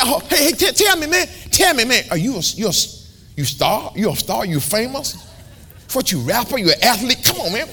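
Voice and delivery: high, pushy mock street voice; eager stranger. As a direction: pester him like a starstruck fan. Stranger quizzing preacher.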